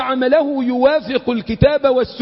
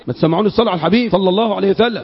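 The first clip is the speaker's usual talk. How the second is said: ordinary delivery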